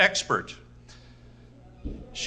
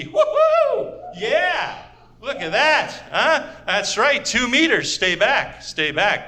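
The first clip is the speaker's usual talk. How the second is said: gleeful crowded person. Retold or imitated. Crowded city dweller cheering about two meters of room. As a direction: gloat over all that space. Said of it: "Those people right now must be".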